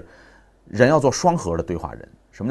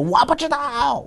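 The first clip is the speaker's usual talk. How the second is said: put-on much higher, slow little-child voice; toddler voice; slower than usual. Son telling father he doesn't know, high toddler voice. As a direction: squeak it like a small boy.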